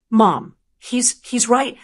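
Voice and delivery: plain reading voice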